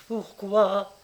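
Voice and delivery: lightly voiced startled wife; stammering. A narrator's reading. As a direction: stammer it out uneasily. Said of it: "bégaya madame Charras".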